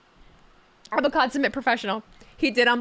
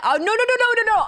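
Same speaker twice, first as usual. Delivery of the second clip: rapid no's jumping much higher; frantic no's; 8 semitones higher, 4 dB louder than usual. Real, not acted. Streamer denying chat's guess, high-pitched rapid no's. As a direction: wave off the guess in a panic.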